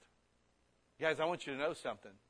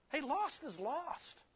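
mock retort; 12 semitones higher, slower than usual. Preacher answers the excuse.

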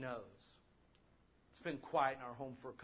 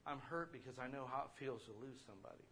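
ordinary talk, nothing marked

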